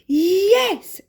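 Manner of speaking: high, loud triumphant yes; excited exclamation. A narrator's reading. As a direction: cheer like you've already won. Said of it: "exclaimed Darvish".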